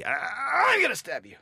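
high, slow grunted threat; effort grunt. Pretend. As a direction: grunt it out like a hard serve. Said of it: "you need to be more threatening".

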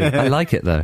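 normal talking voice

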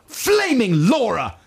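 high, drawn-out mock exclamation; imitated shout. Imitating the manager whenever he was upset, high slow mock shout. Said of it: "used to shout".

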